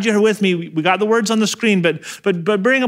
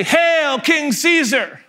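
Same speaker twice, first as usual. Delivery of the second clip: mock salute; 9 semitones higher, slower than usual. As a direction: hail him as a joke. Soldiers taunting Jesus.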